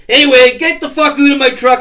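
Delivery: loud, high kicking-out; loud high telling-off; angry order. Retold or imitated. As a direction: bark him out of the truck. Throwing the guy out.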